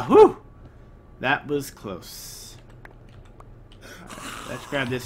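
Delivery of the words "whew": relieved sigh. Real, not acted. After narrow escape.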